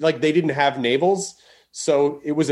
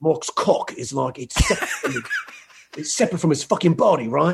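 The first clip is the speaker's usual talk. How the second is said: darker, more intense. Pretend. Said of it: "Same thing, but darker, more intense".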